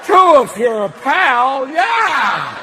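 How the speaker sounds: loud folksy aw-shucks voice; Jimmy Stewart. Retold or imitated. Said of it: "Did Jimmy Stewart basically go".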